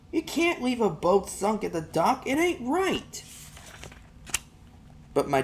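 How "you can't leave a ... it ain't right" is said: put-on high boy's voice, protesting; a boy pushing his father to act; upset boy. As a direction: insist it's wrong like a stubborn kid.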